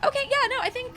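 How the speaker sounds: chirpy high influencer voice; high pitched. Acted. Influencer fussing before ad.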